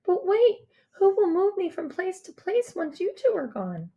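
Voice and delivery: put-on high, urgent child's question; worried girl. A narrator's reading. Girl worried about being left.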